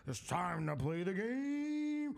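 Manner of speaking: high, slow, booming intro call; Big Daddy Mark style entrance. Retold or imitated. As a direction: belt it like a ring announcer. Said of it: "Give me some Big Daddy Mark".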